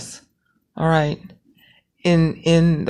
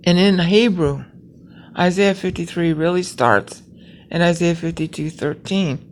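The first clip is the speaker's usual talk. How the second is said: normal talking voice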